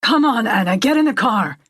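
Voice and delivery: impatient shout; shouted urging. A narrator's reading. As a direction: urge her loudly. Man shouting back in roadside quarrel.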